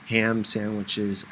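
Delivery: plain reading voice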